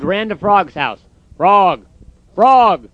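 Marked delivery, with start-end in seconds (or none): 1.4-2.9 loud, high call of a friend's name; crying out. A narrator's reading. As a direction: shout it out, rising in urgency. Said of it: "he cried".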